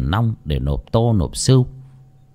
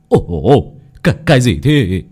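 stammering fright, a little louder; startled cry; 3 dB louder than usual. Acted. Old man startled by a cold hand. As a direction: yelp as a cold hand grabs you. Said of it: "Ông hú lên một tiếng".

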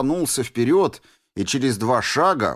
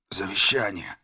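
hoarse rasp; 3 semitones lower, 4 dB quieter than usual. A narrator's reading. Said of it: "прохрипел Миша".

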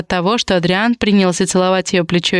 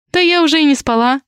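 lightly brushing it off, higher pitched; dismissive; 7 semitones higher than usual. A narrator's reading. Raisa answering Svetlana's apology for dropping in, lightly dismissive. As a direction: wave the apology away in a higher voice. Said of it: "отмахнулась Раиса".

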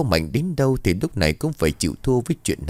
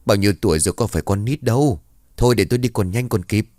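plain reading voice

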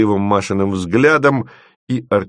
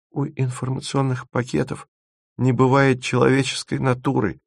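protesting; 6 semitones higher than usual. A narrator's reading. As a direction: object gently in a small, raised voice. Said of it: "запротестовала Маша".

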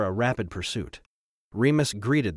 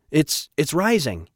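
higher, slow, tense report; tight with tension; 5 semitones higher, 4 dB louder, slower than usual. A narrator's reading. Captain reports the creature rising, tense. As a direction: stammer it out, voice tight. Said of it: "his voice tight with tension".

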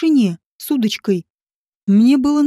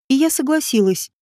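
ordinary delivery, nothing marked

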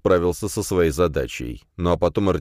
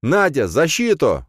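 loud, slow urgent call; shout; 9 semitones higher, 5 dB louder, slower than usual. A narrator's reading. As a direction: yell the order across the field. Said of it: "крикнул я".

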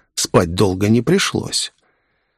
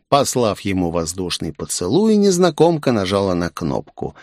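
plain reading voice

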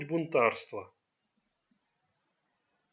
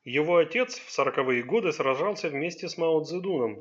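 ordinary delivery, nothing marked

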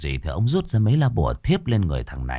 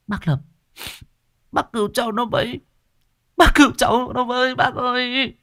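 very high, pleading cry; begging mother. Acted. Mother begging the exorcist to save her son. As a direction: beg him desperately.

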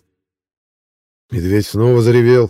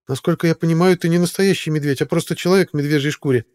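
put-on higher young woman's voice; 7 semitones higher than usual. A narrator's reading. Zoe questions the bear.

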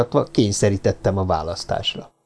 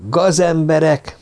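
slow, higher shouted accusation; yelled insult; 6 semitones higher, slower than usual. A narrator's reading. Avenger yelling at his victim, raised voice. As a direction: spit the word into his ear. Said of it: "ordítottam a fülébe".

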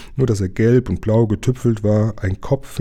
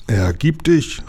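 higher, commanding demand; threatening call; 5 semitones higher, slower than usual. A narrator's reading. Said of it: "rief Lukas so laut und drohend er konnte". (drohend gerufen)

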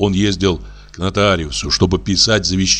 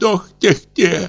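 much higher, slow frightened ох; horrified sigh; 10 semitones higher, slower than usual. A narrator's reading. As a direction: moan it in alarm. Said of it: "в ужасе". (испуганный стон, намного выше)

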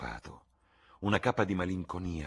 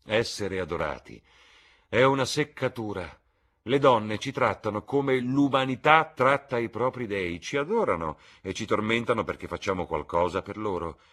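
loud lord, rising into complaint; opinionated Lord Henry; 7 semitones higher, 9 dB louder than usual. Acted. Lord complains of being adored by women, loud and emphatic. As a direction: hold forth with raised, higher voice.

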